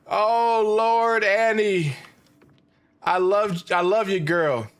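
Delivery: high, loud wailing over a dying Sim; half-joking emotional outburst, voice cracking up high; hammed-up grief, loud and high with a laugh; getting emotional. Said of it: "I'm getting a little emotional".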